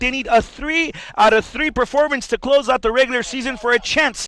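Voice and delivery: loud, high, fast sports hype; hyped commentator. Acted. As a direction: sell the big matchup.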